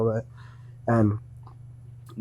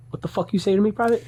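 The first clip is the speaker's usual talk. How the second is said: mock drill sergeant; 9 semitones higher than usual. Sergeant snaps at private.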